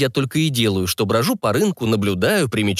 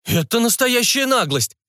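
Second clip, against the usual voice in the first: high, drawn-out outraged protest; slow down on the outrage, pitch up high; indignant; 12 semitones higher than usual. Acted. Seller outraged at a haggling buyer, indignant. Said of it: "начал возмущаться продавец".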